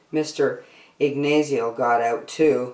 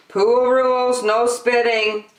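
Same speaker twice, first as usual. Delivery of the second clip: higher and louder, official warning; raised no-nonsense lifeguard call; stern lifeguard; 9 semitones higher, 4 dB louder than usual. A narrator's reading. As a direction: lay down the rule like a lifeguard. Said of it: "he said".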